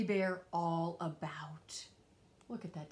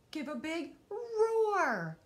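loud, slow and higher, a roar-like call; big drawn-out call; 6 semitones higher, 7 dB louder, slower than usual. Acted. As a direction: stretch it out loud like a roar yourself. Asking the hidden dinosaur to roar.

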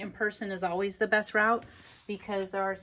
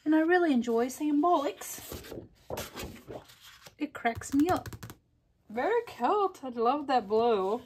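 high, playful fake British accent; mock British accent; 5 semitones higher than usual. Crafter enjoying British words for fun, mock British accent. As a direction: put on an amateur British accent. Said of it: "That was [name]'s accent".

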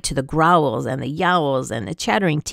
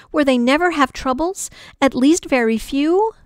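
higher, rising, longing; hopeful, higher question; 7 semitones higher than usual. A narrator's reading. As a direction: ask it with rising hope. Desperate hopeful thought.

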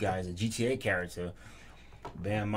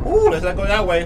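scared; 10 semitones higher, 13 dB louder than usual. Real, not acted. Scared by something in game.

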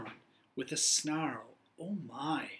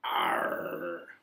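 pirate grumble; 12 semitones lower, 4 dB quieter than usual. Acted.

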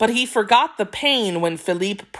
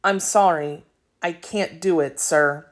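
usual speaking voice

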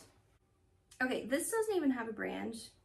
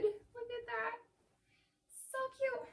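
cooing; 12 semitones higher than usual. Real, not acted. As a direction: coo at something adorable. Admiring tiny dinosaur onesie.

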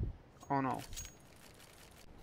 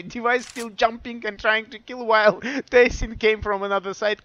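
usual speaking voice

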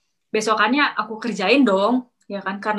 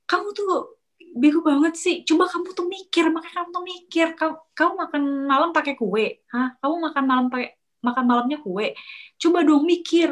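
high-pitched mock scolding; retold angry scolding; 6 semitones higher than usual. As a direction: imitate a coworker berating you, pitched up. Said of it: "langsung teriak-teriak gini". (omelan marah ditirukan dengan nada tinggi)

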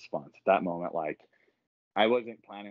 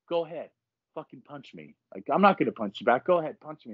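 higher-pitched, insistent retold taunt; fearless dare; 6 semitones higher, 3 dB louder than usual. Gym member daring his aggressor to hit him, fearless. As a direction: goad him into throwing the punch. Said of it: "this is how fearless I felt".

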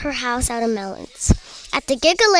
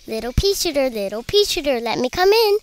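ordinary delivery, nothing marked

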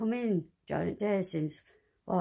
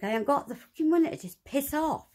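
higher and heated, "fucking money" spat out; angry outburst; 7 semitones higher than usual. Real, not acted. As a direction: challenge them, voice rising in anger. Ranting at the association.

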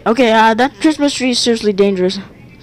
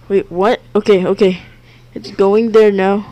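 ordinary talk, nothing marked

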